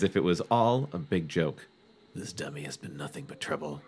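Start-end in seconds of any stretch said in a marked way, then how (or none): 2.1-3.8 hushed, low muttered thought; inner thought. A narrator's reading. Reader voicing Chris's inner monologue, hushed. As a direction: think it aloud, much softer. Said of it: "Chris thought".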